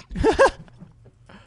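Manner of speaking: loud, high burst of laughter. Real, not acted. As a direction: crack up in a high-pitched laugh. Laughs at falling ladder.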